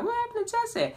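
imitating worried aunts; fussing relatives. Relatives crowding round the hurt boy, mock worried.

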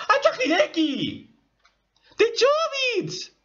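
very high squeal, stretched at the end; thrilled discovery. Real, not acted. Fan finding out about Hideki from Chobits, thrilled. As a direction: squeal it out in delighted shock.

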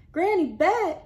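high loud call. Acted. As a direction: call to her eagerly. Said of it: "cried Railroad Hank".

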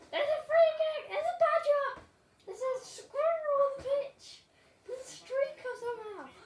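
high, loud mock alarm, announcing each silly thing on the pitch; mock excited shouts. Kid commentator to viewers, hammed-up excited yelling. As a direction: yell it like a giddy commentator spotting chaos.

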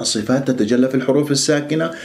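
usual speaking voice

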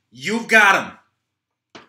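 very slow, loud closing threat; slow final threat. Acted. Troy ends the call with a threat, loud and slow. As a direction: drag out the threat, then hang up.

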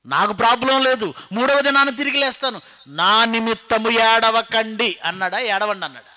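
high, loud rhetorical challenge; full-force preaching. Acted. Preacher challenging what Jesus really said, high loud delivery. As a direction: drive each phrase at the congregation.

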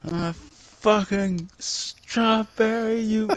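high, slow, drawn-out protest; mock indignation. Strawberry player correcting being called a tomato, slow high indignation. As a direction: stretch each word in offended correction.

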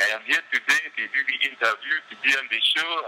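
retelling a mock African accent like Eddie Murphy's Coming to America; a hint of the Coming to America accent for the casting interview; Eddie Murphy impression. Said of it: "I had the voice and everything".

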